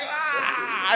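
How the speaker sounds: mock yell. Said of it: "they're like".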